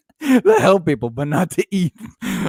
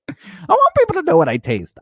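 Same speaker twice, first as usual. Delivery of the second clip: high mock reading; 14 semitones higher, faster than usual. Parodying post, high fast.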